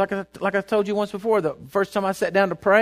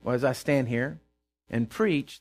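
ordinary talk, nothing marked

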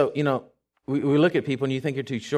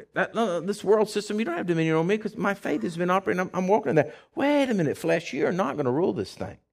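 a rising, higher-pitched declaration of faith; bold declaration; 5 semitones higher than usual. Pretend. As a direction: proclaim it over your life. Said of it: "you begin to declare".

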